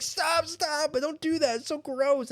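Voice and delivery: imitating a girl begging the teasing boys to stop; mock upset girl. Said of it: "And she'd be like".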